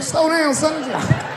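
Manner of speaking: high, sharp hushed scold; scolding father. Retold or imitated. Father scolding his son for eating fast, high pitched. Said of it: "I was like".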